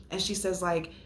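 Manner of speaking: ordinary talk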